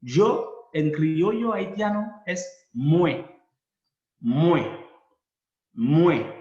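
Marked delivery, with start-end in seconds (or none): 2.2-6.3 "mue" loud, sounding like mweh; Creole pronunciation. Shown as a demonstration. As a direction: model "Muen" as mweh, stressed. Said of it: "W en criollo es como la U en castellano". ("Muen" enseñado en voz alta como mwe)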